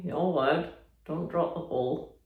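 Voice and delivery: usual speaking voice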